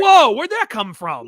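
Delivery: loud, high, drawn-out astonishment; exaggerated startled reaction; taken aback. Viewer reacting to Professor X's sudden insult, loud and startled. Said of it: "And it's like".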